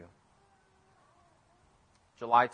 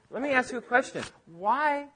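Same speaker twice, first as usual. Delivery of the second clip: searching question; 10 semitones higher than usual. Retold or imitated. Jesus questioning the scribes.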